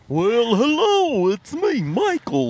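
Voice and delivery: slow, high, drawn-out goofy greeting; Michael impression. Podcaster introducing himself as an absent friend, high impression voice. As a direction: draw out each word in a high put-on voice. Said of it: "That's pretty close".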